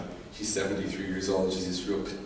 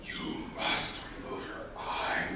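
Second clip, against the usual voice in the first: hushed ominous command; 8 dB quieter than usual. Acted. Acting ominous command in dark room.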